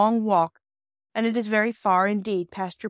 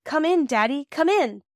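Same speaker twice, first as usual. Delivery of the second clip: higher, louder call from the doorway; 6 semitones higher, 4 dB louder than usual. Acted. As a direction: call her father inside, happy. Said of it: "Bobby's voice calls".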